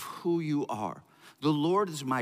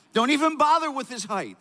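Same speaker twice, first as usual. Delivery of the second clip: emphatic paraphrase; 8 semitones higher, 4 dB louder than usual. Acted. Preacher paraphrases scripture forcefully.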